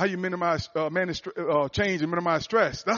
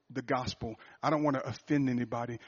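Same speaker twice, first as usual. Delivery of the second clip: normal talking voice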